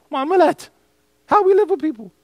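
a big, high, ringing answer to his own question; emphatic answer. Real, not acted. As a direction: announce it like the punchline.

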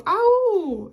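high, loud, drawn-out halloo; calling out. Acted. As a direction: call as if searching for someone. Written boy calls out "au.

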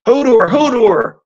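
very high, loud repeated yell. A narrator's reading. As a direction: yell the name at the storm. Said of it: "Hodor yelled again".

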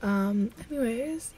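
usual speaking voice

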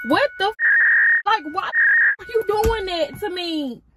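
high, loud, fed-up cry at the car; exasperated outburst. Real, not acted. Car won't start, she yells at it in real exasperation. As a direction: yell at the car in real frustration.

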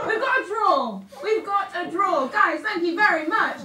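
loud, laughing announcement; excited. Real, not acted.

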